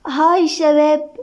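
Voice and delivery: put-on sweet hello; smiling greeting. A narrator's reading. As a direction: greet the group with a drawn-out, smiling coo. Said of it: "سالي بابتسامة".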